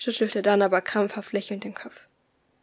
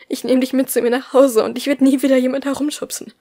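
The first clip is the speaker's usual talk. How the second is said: raised, loud, insistent promising; urgent encouragement; 3 semitones higher, 6 dB louder than usual. A narrator's reading. Tim urges the tearful boy toward freedom, loud and insistent. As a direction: urge him on with eager promises.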